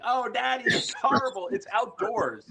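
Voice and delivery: very high, hammed-up lament; high mock whimpering complaint; wailing imitation. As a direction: imitate a horrified man in a high voice. Fozzie complaining of stench, retold.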